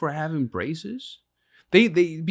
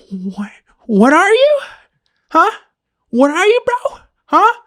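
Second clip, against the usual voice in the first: much higher, louder, slow roaring demand; mock yell; 12 dB louder than usual. Dad yelling in mock rage at his son. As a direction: bellow it in his face, hammed-up. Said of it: "I'm like".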